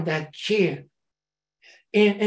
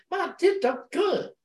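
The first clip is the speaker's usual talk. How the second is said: mock commercial line; 11 semitones higher than usual. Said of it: "like that stupid commercial".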